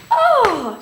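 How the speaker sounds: high, loud, falling "oh"; delighted grandmotherly exclamation, swooping down; admiring gasp. Acted. Nana sees her granddaughter dressed up, delighted high exclamation.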